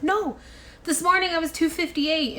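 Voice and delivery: high-pitched insistent objection; retold protest, voice jumping high; indignant protest. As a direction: push back at the number, pitched well up. Retelling her protest at weigh-in.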